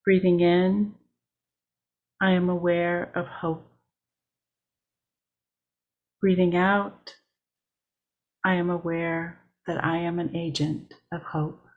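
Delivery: measured meditation read with long pauses between lines; steady mindful guiding voice, line by line; guided meditation. Acted. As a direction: lead the breathing lines unhurried, letting each settle. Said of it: "I will begin with a guided meditation".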